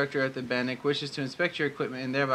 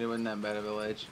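ordinary talk, nothing marked

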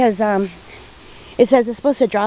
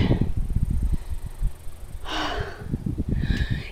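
tired sigh; 5 semitones higher, 8 dB quieter than usual. Real, not acted. Resting in the heat.

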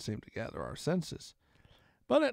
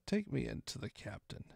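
cold menacing voice; 4 semitones lower, 7 dB quieter than usual. A narrator's reading. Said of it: "a voice so cruel and cold and ugly".

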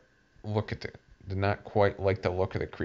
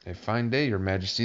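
plain reading voice

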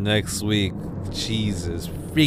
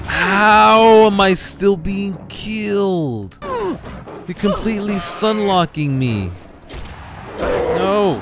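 exasperated; 7 semitones higher, 4 dB louder, slower than usual. Real, not acted. Losing the arena fight.

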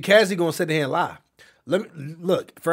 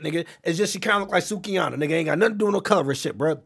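ordinary talk, nothing marked

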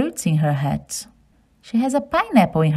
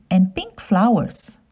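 ordinary delivery, nothing marked

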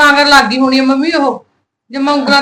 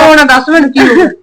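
louder, pleading through worry; urgent plea; 4 dB louder than usual. Acted. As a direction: press her to tell. Sister-in-law urging the crying girl. (ਘਬਰਾਈ ਹੋਈ ਉੱਚੀ ਆਵਾਜ਼)

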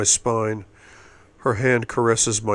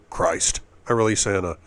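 plain reading voice

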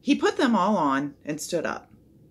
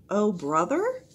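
higher, questioning repeat; puzzled echo; 4 semitones higher, slower than usual. A narrator's reading. Priscilla repeats Jack's odd phrase, puzzled. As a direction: lift "brother" into a baffled question. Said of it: "repeated Priscilla".

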